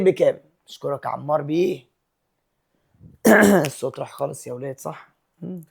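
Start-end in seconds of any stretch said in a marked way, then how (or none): none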